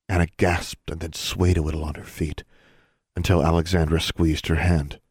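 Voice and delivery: usual speaking voice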